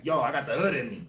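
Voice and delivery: quick, brash street-tough boast. Retold or imitated. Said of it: "but do I lead with that? Like".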